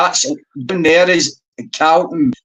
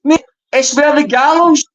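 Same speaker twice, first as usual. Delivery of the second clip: exasperated; 12 semitones higher than usual. Real, not acted. Exasperated at friend over map.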